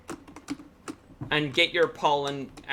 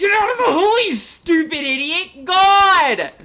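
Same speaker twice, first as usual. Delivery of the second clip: much higher and louder exasperated scream; real gamer rage, shouting at the screen; frustrated yelling; 11 dB louder than usual. Real, not acted. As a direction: yell at the stupid character stuck in the hole. Yelling at stuck game character.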